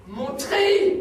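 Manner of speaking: loud, high yell; shouting. Acted. Son yells for his uniform, mother begs him to stop. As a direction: scream the demand. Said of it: "Ne crie pas".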